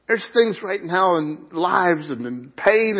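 ordinary talk

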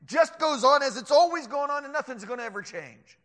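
high, loud dismissive rant; intimidating scoffer. Acted. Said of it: "it can be intimidating when the scoffers scoff".

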